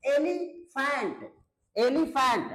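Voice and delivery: एलिफेंट slowly broken into its syllables. Shown as a demonstration. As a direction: draw out एलिफेंट in separate beats. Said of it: "यानी एक, दो, तीन".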